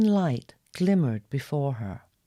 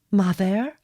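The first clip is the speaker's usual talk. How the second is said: a raised, louder questioning call; hopeful query; 4 semitones higher, 4 dB louder than usual. A narrator's reading. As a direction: call out hopefully into the dark water. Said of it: "the girl queried the ocean".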